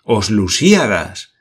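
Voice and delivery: slow, stunned exclamation; exclaimed. A narrator's reading. Glenarvan exclaiming at the book title, stunned. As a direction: say the title in disbelief. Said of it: "exclamó Glenarvan".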